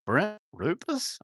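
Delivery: high, slow, unsure question; timid stranger checking a name; shy fan asking. Retold or imitated. Fan in a store asking his name, high and hesitant. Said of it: "they go".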